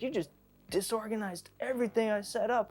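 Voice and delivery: high-pitched, aggrieved complaint; mock outrage, voice pushed up high; retold angry clerk. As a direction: imitate an irate shopkeeper scolding a customer. Acting out clerk accusing him of mess.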